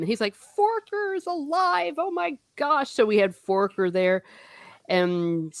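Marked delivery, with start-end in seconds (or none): 0.4-2.9 thrilled. Retold or imitated. Reader learning that Forker is alive, thrilled.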